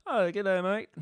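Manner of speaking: attempted London accent gone Australian. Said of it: "That would be Australian".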